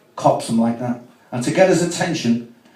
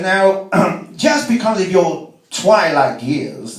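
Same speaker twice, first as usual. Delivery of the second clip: pompous judge; 4 semitones higher, much slower than usual. Retold or imitated. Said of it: "And the beak says".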